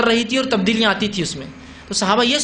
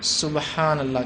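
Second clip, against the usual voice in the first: slow, softer subhanallah; soft reminder; 4 semitones lower, 5 dB quieter, much slower than usual. Shown as a demonstration. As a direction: gently prompt the imam. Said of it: "مرد کیا کریں گا لقمے کے طور پہ".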